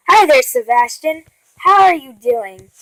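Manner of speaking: ordinary delivery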